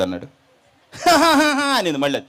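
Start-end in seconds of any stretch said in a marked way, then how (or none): 0.9-1.7 loud, high mocking cackle; demonic laugh.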